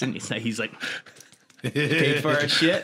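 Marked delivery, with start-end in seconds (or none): 1.6-2.8 sulky mumble. Retold or imitated. Imitating defeated stranger.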